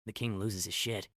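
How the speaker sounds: ordinary delivery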